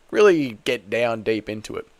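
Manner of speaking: usual speaking voice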